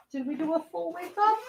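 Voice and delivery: high, soft, quick pet voice; high cooing. Real, not acted. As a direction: coo brightly to a puppy. Cooing to waking puppy.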